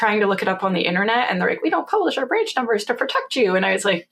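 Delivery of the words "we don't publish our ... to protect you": lightly mocking, higher-pitched official voice; mock official excuse. Bank relaying its excuse to a customer, lightly mocking. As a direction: parrot the official line, pitched up.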